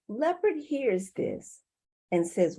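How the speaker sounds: ordinary delivery, nothing marked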